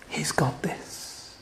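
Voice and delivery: very soft, slow whisper; hushed, unhurried assurance; whispered reassurance. Real, not acted. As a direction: whisper comfort to a worried believer. Preacher reassures congregation in a whisper.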